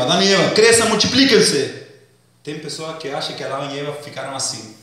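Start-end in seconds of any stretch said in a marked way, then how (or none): 0.0-1.8 big loud proclamation, God speaking; booming voice of God. Retold or imitated. Preacher voicing God commanding Adam and Eve, booming. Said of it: "quando Deus falou pra Adão e Eva".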